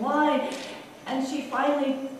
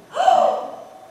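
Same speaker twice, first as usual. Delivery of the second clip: high, loud delighted gasp; sharp gasp; 8 dB louder than usual. Acted. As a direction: gasp with sudden hope. Woman hears marry advice.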